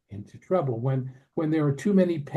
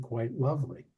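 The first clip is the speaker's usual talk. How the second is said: normal talking voice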